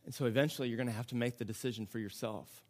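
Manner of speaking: spaced phrases, each left to settle; solemn preaching. Acted. Preacher calls every listener to decide, hushed solemn appeal.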